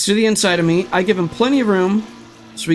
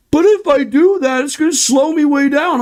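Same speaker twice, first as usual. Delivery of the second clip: higher, whiny put-on voice mocking the excuse; 7 semitones higher, 4 dB louder than usual. Streamer mocks other racers' excuse in a whiny voice. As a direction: pout it out like a spoiled driver.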